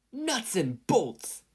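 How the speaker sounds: two inventors cursing their matchup; yelled. A narrator's reading. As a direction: cry it out in shock. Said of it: "they both yelled".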